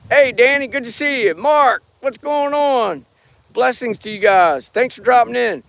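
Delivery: cheery high shout-out to chat names; livestream shout-out. Acted. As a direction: beam a quick hello to viewers. Greets live chat viewers.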